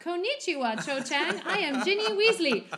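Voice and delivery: high, loud chirpy mock greeting; a hammed-up high bubbly hello; mock Ginny greeting. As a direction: squeal it out like an overeager schoolgirl. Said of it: "is like".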